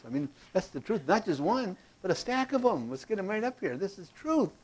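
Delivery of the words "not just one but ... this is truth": hammed-up oath. Teacher hams up the oath.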